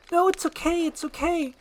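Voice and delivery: high, hurried pleading princess; urgent reassurance. Acted. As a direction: try to hush a screamer. Princess hushes screaming donkey.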